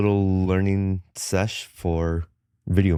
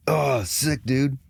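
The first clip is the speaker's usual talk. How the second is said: higher-pitched hyped "sick, dude"; mock impressed slang; 7 semitones higher, slower than usual. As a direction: act out a stoked dude's praise. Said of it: "Yeah, like, like".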